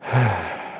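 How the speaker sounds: long, soft, slow exhale; deep breath out. Shown as a demonstration. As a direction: breathe out deeply to show them how. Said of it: "Take one deep breath with me right now".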